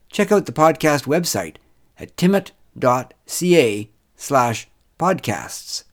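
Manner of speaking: loud, measured closing credits read; projected, careful outro voice; podcast announcer sign-off. Acted. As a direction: announce it deliberately, spacing out the web address.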